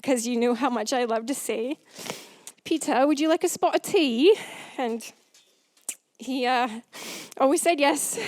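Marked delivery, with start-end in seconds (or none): 2.6-4.4 put-on a playful English accent, higher and louder. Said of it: "my English accent".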